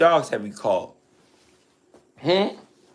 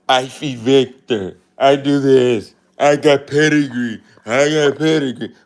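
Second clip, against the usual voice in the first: mocking quote; 4 semitones lower, 4 dB louder than usual. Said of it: "But these".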